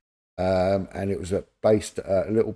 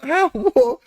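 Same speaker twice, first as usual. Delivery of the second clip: mock disbelief; 7 dB louder than usual. Acting his own disbelief.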